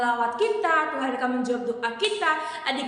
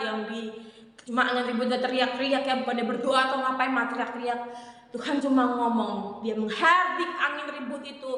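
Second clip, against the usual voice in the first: normal talking voice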